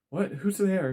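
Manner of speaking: high, loud startled "Who's there?"; jumping in fright, voice shooting up; startled cry. Acted. A woman startled in the dark woods shouts in fright.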